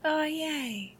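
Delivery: high, happy little cheer; light up with joy at the yes; delighted squeal. Acted. Girlfriend cheering as her partner agrees to stay on the call, delighted.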